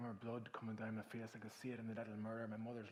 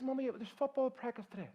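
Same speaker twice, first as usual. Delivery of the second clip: put-on high, loud child's protest; small boy protesting to his mum; pleading child; 13 semitones higher, 9 dB louder than usual. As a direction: whine it up like a kid.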